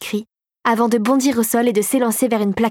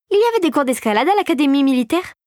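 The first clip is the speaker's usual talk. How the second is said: put-on higher-pitched voice for a curious girl; questioning girl; 5 semitones higher than usual. A narrator's reading. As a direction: ask it as the girl, pitch lifted. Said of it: "l'interrogea-t-elle".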